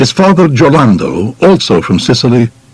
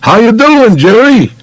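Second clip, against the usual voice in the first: a sidewalk hello shouted over the traffic; calling out; 12 semitones higher than usual. Retold or imitated. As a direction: hail him from across the street, friendly. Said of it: "wave and call out".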